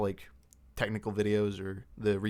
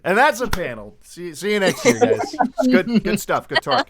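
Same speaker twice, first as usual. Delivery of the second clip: high, loud jokey wrap-up; playfully hurried goodbye, pitched up; mock sign-off; 10 semitones higher, 7 dB louder than usual. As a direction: wrap it up in pretend finality. Pretends to wrap up the panel.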